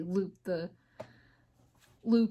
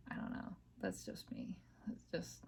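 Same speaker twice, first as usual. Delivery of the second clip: whispered aside; 13 semitones lower, 15 dB quieter than usual. Real, not acted. Speaker whispers to herself after admitting a struggle. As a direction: trail off into a whisper.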